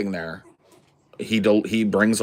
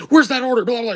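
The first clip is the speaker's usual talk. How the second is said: high, loud mock waitress demand; barking; 14 semitones higher, 6 dB louder, faster than usual. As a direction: bark at the cook in a high voice. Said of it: "She starts barking at him".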